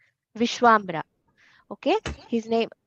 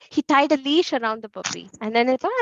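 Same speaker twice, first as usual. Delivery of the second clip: usual speaking voice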